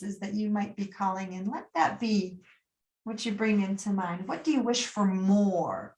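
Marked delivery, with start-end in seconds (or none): none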